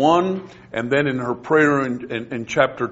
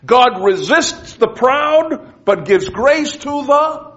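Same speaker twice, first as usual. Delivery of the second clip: much higher, slow and forceful; full preaching; 11 semitones higher, 5 dB louder, slower than usual. Real, not acted. Preacher proclaiming to the class, slow and forceful. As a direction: drive each word home from the pulpit.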